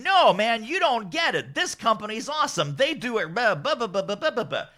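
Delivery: high-pitched mock defence, running into babble; imitated indignant fan. Imitated super fan defending company.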